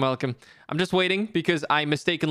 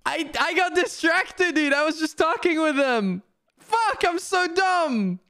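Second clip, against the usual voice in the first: high, drawn-out self-blaming wail; exasperated high lament; dismayed whine; 10 semitones higher, slower than usual. Real, not acted. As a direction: whine it up high, stretching the words. Lamenting own distraction.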